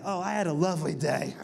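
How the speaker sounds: dry sarcastic lovely day. Real, not acted. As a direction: say it with heavy irony. Ironic after hated event.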